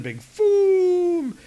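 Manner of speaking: high booming effect; mock boom. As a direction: shout the boom cartoon-style. Sound effect.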